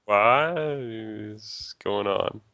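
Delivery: very slow, baffled; slow bewildered. Real, not acted. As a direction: draw each word out confused. Confused slow question.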